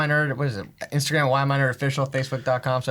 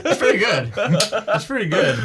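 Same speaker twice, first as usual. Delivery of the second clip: laughing; 7 semitones higher, 3 dB louder, slower than usual. Real, not acted. Laughing at the impression.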